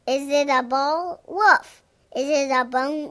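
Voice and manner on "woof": quick high woof; playful dog bark. Pretend. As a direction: yip like a puppy. Dog barking in picture book.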